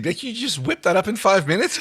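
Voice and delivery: ordinary talk, nothing marked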